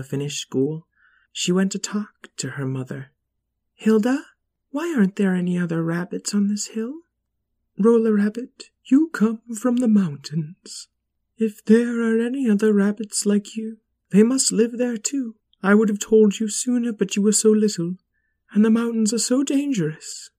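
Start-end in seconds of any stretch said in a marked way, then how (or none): 3.7-7.0 lightly voiced curious bunny; young rabbit voice. Young rabbit asks mother. 7.8-20.3 higher, gentle mother rabbit. Acted. Mother Hilda answers.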